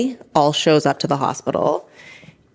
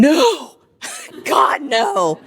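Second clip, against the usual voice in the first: inner scream; 8 semitones higher, much slower than usual. Retold or imitated.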